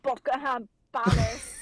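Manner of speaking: cried-out British exclamation; hammed-up British accent; mock British accent. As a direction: shout it like a posh Brit. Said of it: "when the British accents come out".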